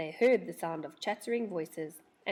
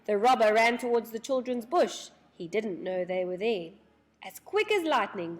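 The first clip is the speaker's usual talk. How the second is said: ordinary delivery, nothing marked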